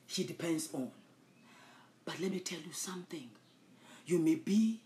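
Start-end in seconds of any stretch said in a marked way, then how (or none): 2.1-3.3 hushed, breathy aside; confiding whisper before the point. Real, not acted. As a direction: lean in and let them in on a secret.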